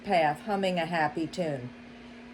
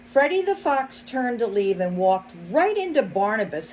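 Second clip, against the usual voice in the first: ordinary delivery, nothing marked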